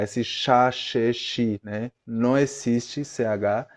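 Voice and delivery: ordinary talk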